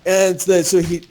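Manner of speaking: ordinary talk, nothing marked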